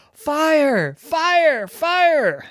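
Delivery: loud, high repeated warning cries; alarm shout. A narrator's reading. Ducky warns everyone nearby of danger, shouting in alarm. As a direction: sound the alarm at full call.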